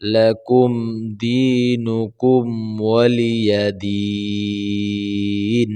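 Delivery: slow, melodic tajweed recitation; measured chanted reading of the Quran; Quranic recitation. Shown as a demonstration. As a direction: recite the sura slowly, each word drawn out. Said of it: "আমি যেভাবে পড়ি".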